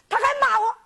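high-pitched tattling voice; complaining youngster. Acted. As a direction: tell on him in a high voice. Child complains to teacher in story. (孩子高声告状)

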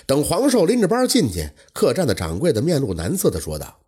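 ordinary delivery

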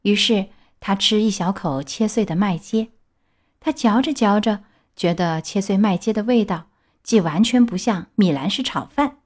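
plain reading voice

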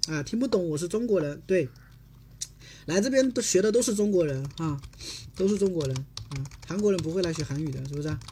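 ordinary talk

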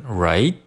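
"right" climbing as a question; rising tune. Shown as a demonstration. Teacher demonstrating the question tune, rising "right". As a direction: lift "right" questioningly at the end. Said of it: "进行一个升调".

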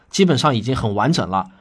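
ordinary delivery

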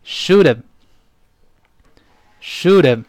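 "should've" run together, the h dropped, said twice with a gap; linked model reading. Shown as a demonstration. Teacher leading learners in repeating, "should've" linked and weakened. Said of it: "我们再读一下这个特别常见的".

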